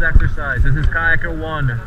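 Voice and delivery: ordinary talk, nothing marked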